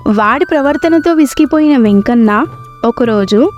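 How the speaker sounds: plain reading voice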